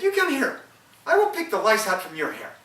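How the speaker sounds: the wife voiced high and loud. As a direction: call him over in a high, bright-loud wife voice. Wife voiced in folk tale.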